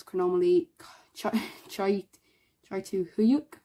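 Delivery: halting, broken tries at a hard name; stumbling pronunciation. Real, not acted. Collector reading out a hard card name, stumbling. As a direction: trip over the name, restarting after pauses. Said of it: "I just cannot pronounce it".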